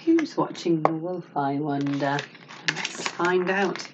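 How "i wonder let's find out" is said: plain reading voice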